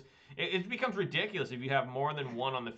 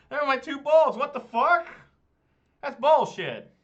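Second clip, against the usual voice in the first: high, loud furious yelling; raging gamer; 13 semitones higher, 14 dB louder than usual. Real, not acted. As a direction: rant at the unfair draw. Raging at opponent's lucky draw.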